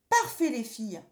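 put-on high, loud child's cheer; jubilant. Boy has tricked both witches, jubilant. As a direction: crow in triumph. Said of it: "jubila Pierre".

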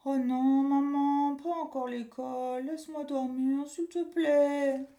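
put-on high, drawn-out whine of a sleepy boy; pleading child. Boy begs his mum to let him sleep, whining. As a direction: beg to be left asleep.

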